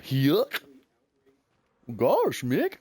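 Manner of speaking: high, loud cartoon goofball yelp; goofy voice. Streamer showing chat his goofy cartoon voice. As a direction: bounce it up like a silly cartoon dog. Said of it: "I do do the goofy voice".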